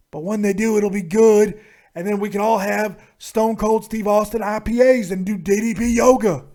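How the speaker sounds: loud wrestler promo, hard-hit phrases; Stone Cold Steve Austin. Acted. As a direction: rant like a brash wrestling star. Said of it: "you think that you're Stone Cold Steve Austin".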